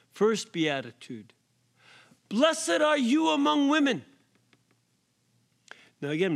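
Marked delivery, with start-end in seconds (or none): 2.3-4.0 much higher and louder, proclaimed; ringing proclamation. Acted. Elizabeth greets Mary with her blessing, ringing proclamation. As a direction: cry it out like Elizabeth filled with the Spirit.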